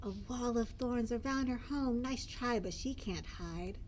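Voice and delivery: usual speaking voice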